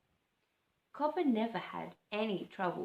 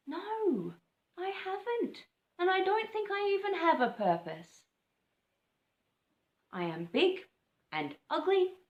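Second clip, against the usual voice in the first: put-on higher, self-pitying young bird; glum Cobber; 9 semitones higher, 7 dB louder than usual. Cobber telling the owl he has no purpose, higher and glum.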